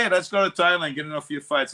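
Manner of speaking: lightly mocking excited friend; mock eager invite. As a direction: pitch it up like a buddy itching for a fight. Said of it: "He goes".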